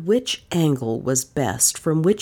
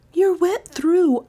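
mechanical politeness; slower than usual. Acted. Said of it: "with mechanical politeness".

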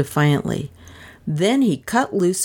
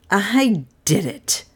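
chortling; 4 semitones higher, slower than usual. A narrator's reading. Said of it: "Doan chortled".

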